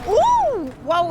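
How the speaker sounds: high, loud thrilled squeal; excited whoop. Real, not acted. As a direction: squeal with delight at the spin. Car spins into a donut.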